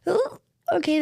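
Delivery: high, sudden "Oh, okay" of alarm; startled realization. Retold or imitated. To the co-host, reliving the money panic: high startled gasp. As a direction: jump up in pitch, caught off guard. Recalling shock at expenses.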